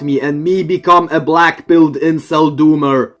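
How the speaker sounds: loud, dumbed-down parody of himself; caveman simpleton. Acted. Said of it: "here is what everyone thinks".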